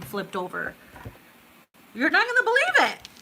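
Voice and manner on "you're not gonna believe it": much higher, fast thrilled squeal; excited squeal. Real, not acted. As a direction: burst out with disbelieving excitement. Excited about flipped card.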